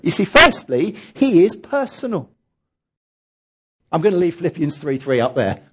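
usual speaking voice

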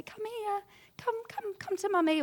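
put-on mother calling a fallen child, high and cooing; soothing mummy voice. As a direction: coax a hurt toddler into your arms. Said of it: "we open our arms and we say".

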